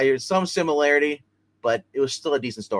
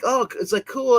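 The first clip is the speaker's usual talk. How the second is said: higher, lightly gushing new recruit; a teen thrilled but playing it cool; mock excited kid; 10 semitones higher than usual. New Robin reacts to his new role, mock gushing. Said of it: "the new Robin's like".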